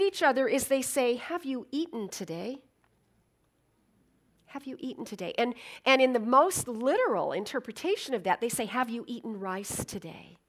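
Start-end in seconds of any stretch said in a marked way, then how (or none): 1.1-5.3 much softer, tender question; soft, caring voice; gentle greeting. Shown as a demonstration. 8.6-10.4 soft greeting. Shown as a demonstration. Gentle greeting quoted.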